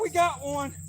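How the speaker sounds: high, thrilled announcement; excited. Real, not acted. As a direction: tell them you've hooked one, thrilled. Celebrating hooked fish.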